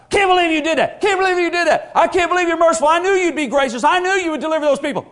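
high, loud mock tantrum; mad Jonah. Preacher voicing angry Jonah shouting at God. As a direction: shout it like a sulking prophet. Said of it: "I call this bipolar Jonah".

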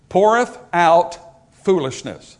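"poureth out" drawn out slowly, then a pause before "foolishness"; emphatic slow quotation. Shown as a demonstration. Preacher pointing out the next three words, "poureth out" slow and emphatic. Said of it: "now notice the next three words".

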